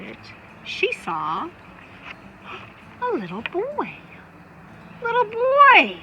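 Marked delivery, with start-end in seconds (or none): 5.0-6.0 high, loud surprised call; exclaiming. A narrator's reading. Amu surprised to find a boy at her door, exclaiming. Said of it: "Amu exclaimed".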